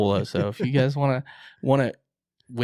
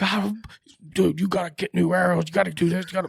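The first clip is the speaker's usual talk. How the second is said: high, raised voice, nagging frantically; retold frantic scolding; 9 semitones higher, 5 dB louder, faster than usual. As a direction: scold a buddy in a pitched-up voice. Voices heated exchange on phone.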